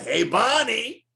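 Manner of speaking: high, slow cartoon call; Flintstones cartoon voice. Flintstones husband calling his wife, cartoon style.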